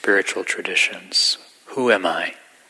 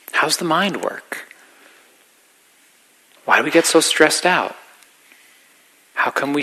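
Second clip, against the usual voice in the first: normal talking voice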